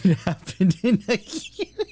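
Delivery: high, laughter breaking into the words; laughing. Real, not acted. Host apologizes for laughing at terrible news, laughing helplessly. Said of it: "I'm sorry I'm laughing".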